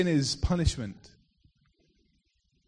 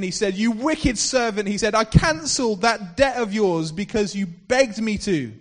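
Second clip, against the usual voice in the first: stern rebuke; 5 semitones higher, 7 dB louder than usual. Acted. Master rebukes the wicked servant.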